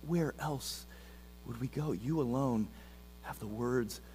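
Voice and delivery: soft, low-voiced reverent quote; Peter's words to Jesus, said hushed and reverent; hushed scripture reading. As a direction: read it gently, almost a prayer.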